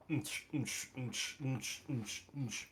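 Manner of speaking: soft, repeated "nch" noises; imitated beat noise. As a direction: mouth a quiet rhythmic "nch" over and over. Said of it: "I'm still up there going".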